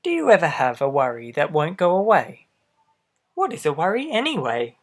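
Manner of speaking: lively storybook read-aloud; reading to children. A narrator's reading. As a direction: read it out to little listeners. Reads picture book aloud.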